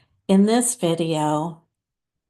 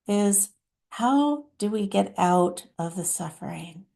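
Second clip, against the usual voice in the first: lightly voiced channeled mother, deliberate and pausing; hint of a solemn mother, phrase by phrase; Divine Mother. Mother addressing her dear children about turmoil, lightly voiced divine guide. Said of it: "let's hear what Divine Mother has to say".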